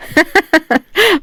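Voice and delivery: high light laugh; a fond giggle. A narrator's reading. Mother-in-law laughing fondly at the bride. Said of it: "وهي بتضحك".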